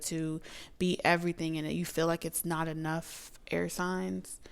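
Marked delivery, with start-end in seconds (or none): none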